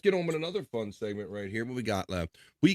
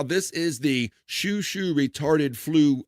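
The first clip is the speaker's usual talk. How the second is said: announcer voice; 5 semitones higher, much slower than usual. Acted. Announcing the clip title.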